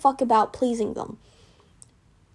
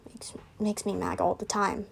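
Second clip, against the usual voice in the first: softer, whispered grumble; 4 semitones lower, 8 dB quieter than usual. Real, not acted. Complaining to viewers about a bully, whispered. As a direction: mutter it under your breath.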